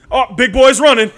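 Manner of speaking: loud, high-pitched yell; onlooker's shout. Retold or imitated. Bystander yelling as the big kid runs, loud shout. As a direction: holler it out as a mocking onlooker.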